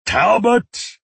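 high, loud yell of a name. A narrator's reading. B T yells to Talbot from the bathroom, yelling. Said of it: "he yelled".

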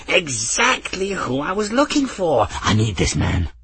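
high, eager old man's voice, lightly voiced; a fussy old scholar pleased to find his man; eccentric old man. Old Ferris to Albion, lightly voiced eager old man.

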